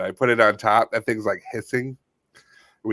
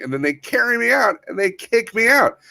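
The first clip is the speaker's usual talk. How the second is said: much higher, wounded tone; hurt possum; 8 semitones higher than usual. Pretend. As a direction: whine it high like a wronged critter. Possum whining about eviction.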